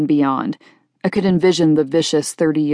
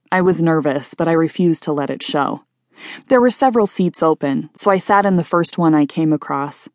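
usual speaking voice

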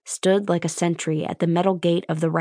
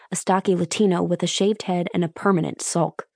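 ordinary delivery

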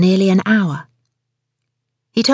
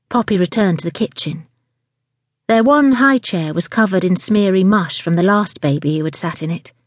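plain reading voice

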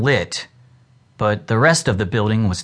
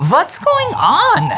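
much higher, slow puzzled voice; confused boy; slower than usual. A narrator's reading. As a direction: sound lost and bewildered. Gus returning, confused.

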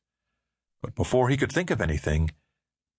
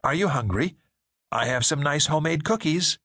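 a higher, sugary offer; lightly voiced woman; 8 semitones higher than usual. Woman offering David cookies, higher and sugary. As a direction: sweeten it up like a too-nice stranger.

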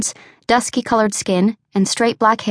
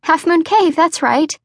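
higher, eager voice confirming the landmark; young woman Misty; 7 semitones higher, slower than usual. A narrator's reading. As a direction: pitch it up, relieved and bubbly.

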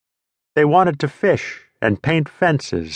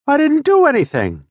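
pleading. Acted. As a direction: beg with "do" jumping high. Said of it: "I pleaded".

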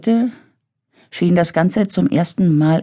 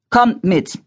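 commanding call; 6 semitones higher than usual. A narrator's reading. Robert takes command.

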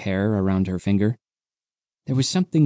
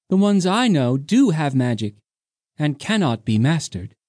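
higher, a touch of anger; higher voiced young woman, mildly cross; 5 semitones higher than usual. A narrator's reading. Undina voiced.

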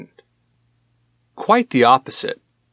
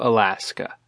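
ordinary delivery

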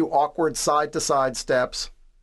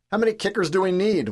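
mild shout, high and quick; shouted question; 6 semitones higher, faster than usual. A narrator's reading. Player to passing teammates as they jog past, shouting. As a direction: call it out fast and high. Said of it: "one player shouts".

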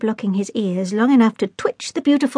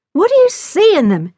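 exasperated; 10 semitones higher, 6 dB louder than usual. A narrator's reading. Jack despairs of her books.

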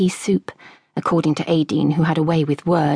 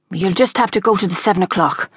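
ordinary delivery, nothing marked

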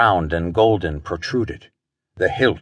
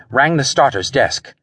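ordinary delivery, nothing marked

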